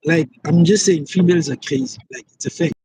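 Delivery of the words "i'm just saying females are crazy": ordinary talk, nothing marked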